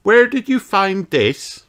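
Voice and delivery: put-on high, slow wondering child voice; curious elf child. A narrator's reading. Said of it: "looking at the object curiously".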